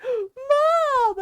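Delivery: high, loud wailed cry for Mom; mock sobbing child calling out. Upset daughter calling to her mom as she's untied, crying. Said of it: "And she's crying".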